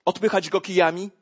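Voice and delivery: ordinary talk, nothing marked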